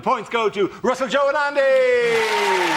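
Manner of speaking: drawn-out, high, loud shout of the names; announcing winners. Real, not acted. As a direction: call the winners out like a game-show host. Host announces round winners.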